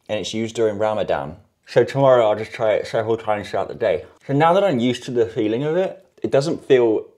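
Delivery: speaking around chewing stick; talking while brushing teeth. Real, not acted. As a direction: stick in mouth. Seen 0: Wooden chew stick rubbed on teeth while talking, briefly lowered near 11.8.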